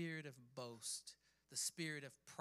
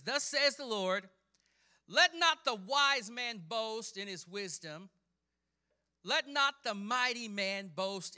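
loud, raised, slowly measured proclamation; forceful scripture preaching; 6 semitones higher, 11 dB louder than usual. Acted. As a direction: declaim it as the word of the Lord. Preacher declaims the Lord's words.

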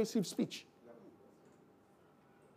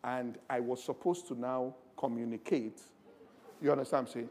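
ordinary delivery

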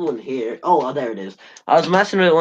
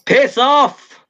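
high, loud yell at the door; shouting piss off; 12 semitones higher, 7 dB louder than usual. Real, not acted. Shouting at his brother at the door to go away. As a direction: yell it across the house.

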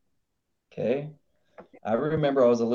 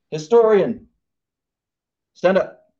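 commanding call; 6 semitones higher, 9 dB louder than usual. Acted. President commanding his cabinet.

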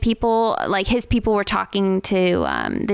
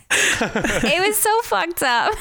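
laughing; 8 semitones higher, much slower than usual. Real, not acted. Laughing about a painful memory.

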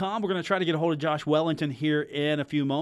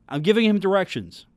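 normal talking voice

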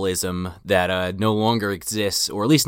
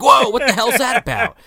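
much higher, strained mock voice; mock Tommy voice; 4 dB louder than usual. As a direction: squeak it out like a panicky guy pretending to be calm. Retelling Tommy's lines.

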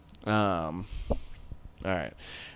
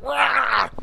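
high, put-on ape roar at the driver ahead; King Kong roar; 4 dB louder than usual. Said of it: "looking like King Kong in the driver's seat".

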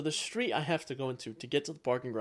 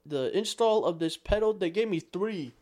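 high, loud, hurried comic complaint; baffled customer; 5 semitones higher, 5 dB louder than usual. Acted. Playing a car owner complaining about extra pedals, baffled. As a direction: play a flustered customer, pitched up and rattling along.

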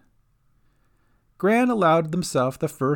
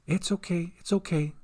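soothing; 4 semitones higher, 6 dB quieter than usual. A narrator's reading. Gran soothes the rescued girl.

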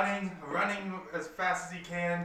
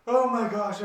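loud, higher burst on finding someone; relieved exclamation; 5 semitones higher, 9 dB louder than usual. Acted. Tang finds Anthem after looking everywhere, loud exclamation. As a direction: exclaim it loudly to a friend.

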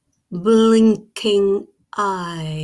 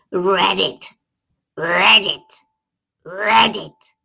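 loud, insistent frog cry, each "Read it!" punched hard on "Read"; frog's shout; 4 dB louder, slower than usual. A narrator's reading. The frog shouting at the hen with the book, a loud insistent "Read it!". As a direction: bellow "Read it!" like a stubborn frog. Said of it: "He shouted at the top of his voice".

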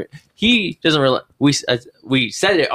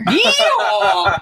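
country yeehaw. Pretend. Mocking her country accent.

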